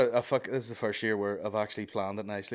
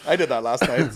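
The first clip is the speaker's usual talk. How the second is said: ordinary delivery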